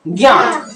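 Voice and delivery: ज्ञात with a nasal vowel, "gyãat"; students' mispronunciation. Retold or imitated. Teacher repeats students' error, nasalized mispronunciation. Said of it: "विद्यार्थी इसको ज्ञात बोलता है".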